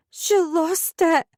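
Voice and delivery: high, slow shaken words; burbled. A narrator's reading. Girl shaken after fleeing her mum, slow high burble. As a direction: babble it in shock. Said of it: "I burbled".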